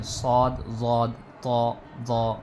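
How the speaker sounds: सौद, वौद, तौ, वौ each said heavy from a full mouth; full-mouthed letters. Shown as a demonstration. Teacher naming heavy letters, each with a full mouth. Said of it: "ये मुंह भर के पढ़े जाएंगे".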